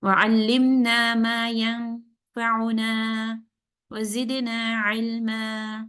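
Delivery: very slow Quranic-style recitation with a pause midway. Shown as a demonstration. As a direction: recite calmly, breaking between phrases. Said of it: "This is how you will read".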